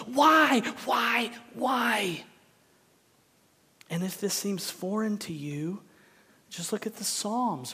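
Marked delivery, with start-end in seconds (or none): 0.1-2.2 loud, high cry of why, easing off; anguished, drawn-out pleading whys; screamed prayer. Retold or imitated. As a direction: cry out to God from the gut. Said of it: "I scream those prayers".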